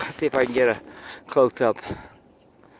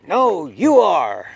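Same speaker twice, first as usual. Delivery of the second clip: slow, higher, louder mock retort; playful yell; 6 semitones higher, 4 dB louder, much slower than usual. Teacher's comeback yell for Harmon, slow and mock-loud. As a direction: drag out the comeback like a kid's quarrel. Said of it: "when I also yell".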